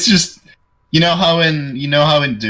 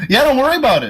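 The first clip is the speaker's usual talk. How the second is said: mock imitation; 3 dB louder, much faster than usual. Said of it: "That was so aggressive".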